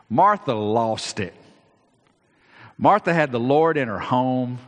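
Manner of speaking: usual speaking voice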